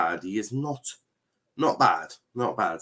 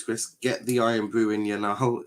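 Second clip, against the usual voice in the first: usual speaking voice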